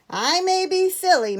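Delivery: high, loud indignant comeback; indignant reply. A narrator's reading. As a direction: answer the insult hotly. Scolded bear answers back sternly.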